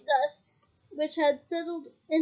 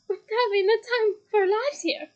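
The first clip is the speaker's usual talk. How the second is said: squashed, higher sarcastic voice; muffled; 6 semitones higher, 4 dB louder than usual. Acted. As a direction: sound pressed against a wall. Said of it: "voice sounded muffled".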